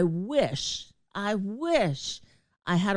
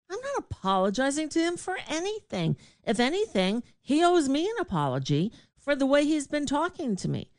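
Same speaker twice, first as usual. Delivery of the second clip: higher, fast, defiant retort; indignant refusal; 8 semitones higher, faster than usual. Retold or imitated. Refusing to apologize to the host, indignant and higher. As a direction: fire it back, refusing to budge.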